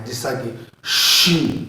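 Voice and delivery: شین said slowly and firmly; firm letter demo. Shown as a demonstration. Teacher modelling a firm sh sound, slow and firm. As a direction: press شین out with a hard sh. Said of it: "سختی کے ساتھ ادا کرنا ہے".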